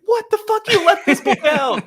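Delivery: very high, loud indignant squawk; hammed-up exasperated protest; mock outrage. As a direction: shriek it in comic disbelief. Acts out reader's outrage.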